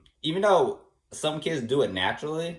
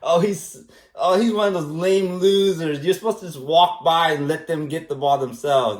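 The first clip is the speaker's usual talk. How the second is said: making fun; 4 semitones higher, 5 dB louder than usual. Retold or imitated. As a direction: imitate a friend jeering at a loser. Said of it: "Andrew was making fun of me".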